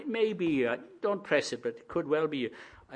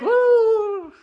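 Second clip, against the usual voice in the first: long, high "woo" like a phantom; mock ghost wail; 11 dB louder than usual. Preacher shows what the frightened disciples imagined, mock ghost wail. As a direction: wail a loud, spooky ghost noise.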